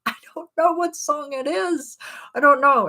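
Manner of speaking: high-pitched, exasperated retold cry; frustrated wail. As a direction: wail it in mock agony. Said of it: "I'm, like, killing myself here".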